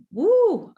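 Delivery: high, swooping "woo" of overwhelm. Retold or imitated. Parent reacting to a child's diagnosis, overwhelmed high woo. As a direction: let out a high-pitched whoosh of disbelief.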